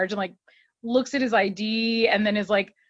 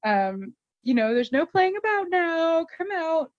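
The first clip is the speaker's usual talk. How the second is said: higher put-on voice calling through a door; mock priest; 9 semitones higher than usual. Nate at the confessional urging the man out, high put-on voice. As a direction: coax someone out of the confessional.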